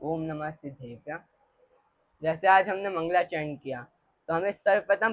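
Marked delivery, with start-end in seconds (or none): 0.0-1.2 murmured softly, like a prayer; hushed opening prayer before class; soft invocation. Real, not acted. Child softly murmurs the invocation before class.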